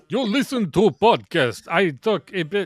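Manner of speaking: put-on high, loud Slavic vampire accent; Zizek Dracula accent. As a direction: ham up a stuttering Slavic accent. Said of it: "I speak like ... Dracula".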